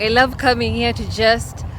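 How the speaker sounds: ordinary delivery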